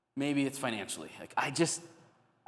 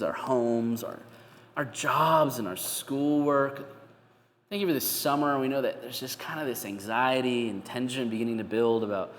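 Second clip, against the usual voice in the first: pastoral prayer; 2 semitones lower, 5 dB louder than usual. Acted. Pastor praying over the congregation, earnest and measured. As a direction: lift the church up to God, steady and earnest.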